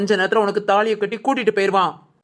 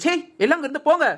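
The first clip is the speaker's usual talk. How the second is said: fast, higher scolding; angry dismissal; 6 semitones higher, faster than usual. Acted. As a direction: shoo them out in a temper. Stepmother chases relatives away.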